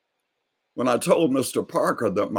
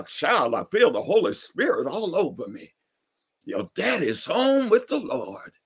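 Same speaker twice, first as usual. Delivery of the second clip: rejoicing preacher; 8 semitones higher than usual. Retold or imitated. Neighbor rejoices over daddy's death, high ecstatic praise. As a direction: feel the Spirit take over.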